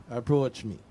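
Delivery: usual speaking voice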